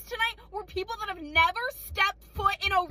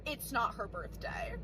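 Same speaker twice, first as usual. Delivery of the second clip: low, quiet and slow; hushed deadpan, dropped right down; mom's deadpan put-down; 8 semitones lower, 9 dB quieter, slower than usual. Retold or imitated. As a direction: imitate a cold, killjoy mom. Retold mom shutting it down.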